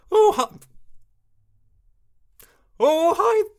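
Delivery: high, hearty put-on hello; character greeting. Retrying the character's greeting, high put-on voice.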